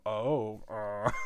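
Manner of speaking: soft, low groan of recognition; knowing parent reaction. Acted. Parents catch the joke kids miss, knowing soft reaction. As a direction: let it drop quietly, as if catching the innuendo. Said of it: "for the parents to go".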